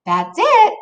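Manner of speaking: very high cheerful mom voice. Acted. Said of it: "says Mom".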